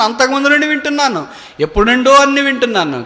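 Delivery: high, loud call and response to God; fervent preaching. Acted. Preacher confessing to God before the church, high and loud. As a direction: cry it out like a revival preacher.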